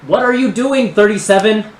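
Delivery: high, loud nerdy scolding; high-pitched nerdy voice. Acted. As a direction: challenge them in a high, nerdy villain voice. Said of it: "the voice continues".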